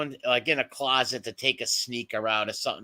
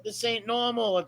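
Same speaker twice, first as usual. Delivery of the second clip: put-on higher, fed-up nagging girlfriend voice; exasperated girlfriend; 7 semitones higher than usual. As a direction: whine it like a fed-up partner. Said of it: "ex-girlfriend saying".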